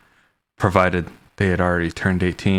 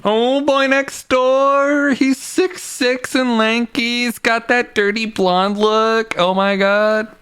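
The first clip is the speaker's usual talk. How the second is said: high, slow, dreamy swooning; swooning fangirl; slower than usual. Pretend. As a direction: gush like a lovestruck teen. Gushing over the love interest.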